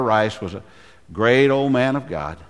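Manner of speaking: normal talking voice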